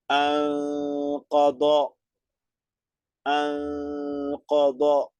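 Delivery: "Anqada" very slow, with a long nasal hum on the nun; nasalized recitation. Shown as a demonstration. Teacher demonstrating two beats of nasalization to the class. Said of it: "Nasalizamos entonces".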